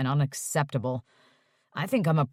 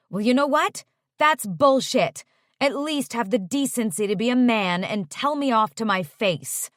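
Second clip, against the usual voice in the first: louder, higher, furious outburst; angry; 6 semitones higher, 4 dB louder than usual. A narrator's reading. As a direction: tell him off angrily. Angry confrontation.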